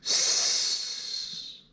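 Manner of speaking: a quiet snake hiss. Shown as a demonstration. Teacher spelling snake for children, soft hiss. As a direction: let the s hiss out softly. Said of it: "And there it is again".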